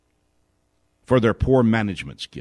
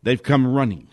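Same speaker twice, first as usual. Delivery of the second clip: normal talking voice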